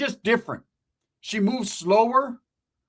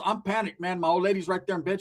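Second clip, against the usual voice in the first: usual speaking voice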